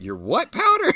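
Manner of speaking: high, shouted disbelief; shocked question. Retold or imitated. Host retelling his reaction in class, shouting in disbelief. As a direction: squeal it like you can't believe your ears. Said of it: "I was like".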